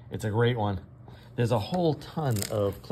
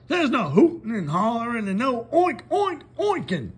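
much higher and louder, mimicking the moo; Farmer Brown mocking moos; 13 semitones higher, 6 dB louder than usual. Farmer Brown lists banned cow noises, mock mooing. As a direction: ham up the forbidden noises. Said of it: "said Farmer Brown".

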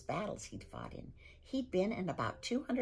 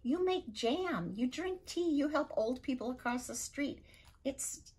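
higher, mock-exasperated Viking father; 7 semitones higher than usual. Viking father scolding son's politeness, exasperated. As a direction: despair over a son who's too nice.

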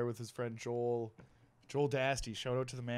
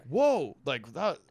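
tag-free awe; 5 semitones higher, 4 dB louder, much slower than usual. Retold or imitated. Acts out his awed younger self.

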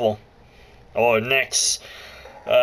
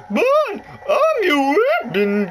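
high put-on voice of the lady game guide; announcer-like high lady voice, slow and bright; 11 semitones higher than usual. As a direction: play the cheery tutorial lady, pitched way up. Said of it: "I hate talking in that voice".

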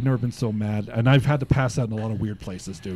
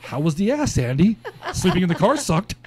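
higher, mocking sarcastic jab at a friend; 7 semitones higher than usual. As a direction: needle him with a laugh. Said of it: "I'm like".

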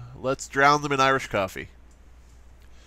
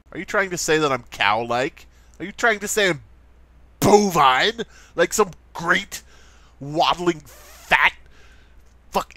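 mock indignation; 3 semitones higher than usual. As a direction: act deeply insulted, word by word. Mock offence at being called bovine.

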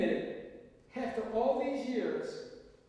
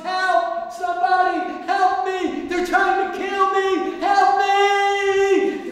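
desperate full-throated yell, much higher and louder; yelling on the highway for passing cars; screaming for help; 8 semitones higher, 13 dB louder, slower than usual. Acted. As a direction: scream for help at the top of your lungs. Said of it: "yelling at the top of my lungs".

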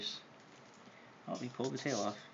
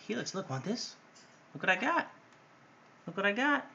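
excited pet talk; 9 semitones higher, 5 dB louder than usual. Real, not acted. Owner teasing her bird with a toy, high and excited. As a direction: dangle the toy and tease, rising on got.

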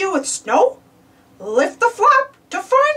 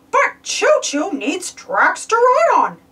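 usual speaking voice

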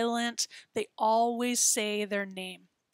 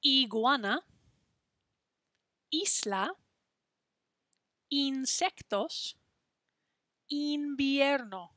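slow model words; 3 semitones higher, slower than usual. Shown as a demonstration. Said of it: "Try saying these words after me".

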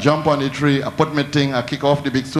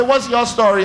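raised voice; 6 semitones higher than usual. Retold or imitated. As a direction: call out to him sharply. Demanding what the man wants.

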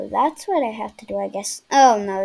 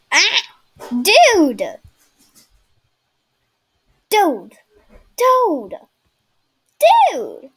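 loud, higher panicked yells; panicking gamer; 7 semitones higher, 8 dB louder, much slower than usual. Real, not acted. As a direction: yelp at each hit in panic. Attacked by giant noob.

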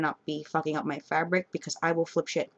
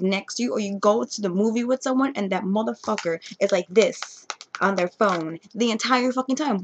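usual speaking voice

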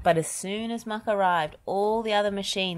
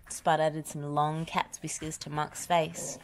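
ordinary delivery

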